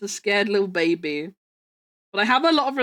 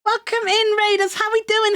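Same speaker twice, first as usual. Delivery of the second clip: excited greeting; 11 semitones higher than usual. Real, not acted.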